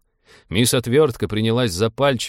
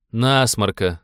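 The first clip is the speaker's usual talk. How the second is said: ordinary delivery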